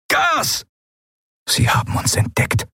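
high, strained shout held back in volume; yelled alarm. A narrator's reading. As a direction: yell a warning from down the corridor. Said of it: "jemanden brüllen hörten". (gebrüllte Warnung, hoch und gepresst)

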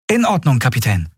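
put-on high, hesitant boy's voice; shy boy. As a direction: answer a little unsure. (zögernde Jungenstimme)

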